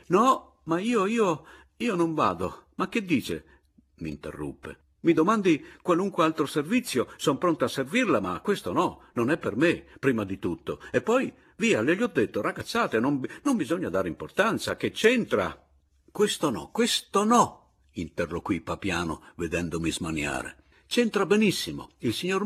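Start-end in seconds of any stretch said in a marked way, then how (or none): 0.1-3.4 very high-pitched, sputtering refusal; flustered protest. A narrator's reading. Anselmo interrupts to refuse the duel errand, flustered. 5.0-15.6 high, agitated refusal with leaping pitch; flustered protest. A narrator's reading. Anselmo begs off being second, flustered and high-pitched. 16.1-17.7 very high, loud, insistent protest; emphatic refusal. A narrator's reading. Anselmo flatly refuses the duel, high and agitated.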